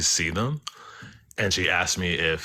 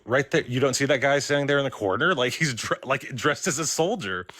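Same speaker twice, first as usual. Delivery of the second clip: higher-pitched, incredulous pointing-out; disbelieving retold protest, pitched up; retold insistence; 7 semitones higher than usual. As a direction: insist on it as if they must see it too. Insists the soldier is visible, retold.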